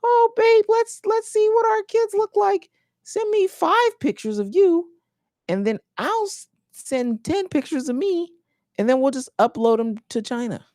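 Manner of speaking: put-on very high, sweet girlfriend voice. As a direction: coo like an excited partner. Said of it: "this is what happens when you".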